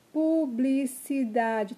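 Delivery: "Publicidade" slowly, beat by beat; teacher separating the syllables of "Publicidade". Shown as a demonstration. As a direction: sound out "Publicidade" as pu, bli, ci, da, de. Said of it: "Tem cinco".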